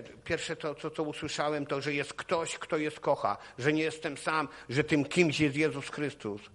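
ordinary talk

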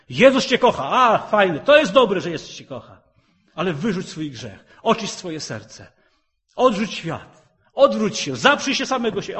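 loud, high, fast fiery preaching; preaching at full force. Acted. Preacher rousing the church to holy living, fiery and loud. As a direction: thunder it at the congregation.

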